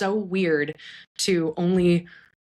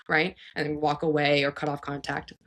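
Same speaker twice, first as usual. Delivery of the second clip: ordinary delivery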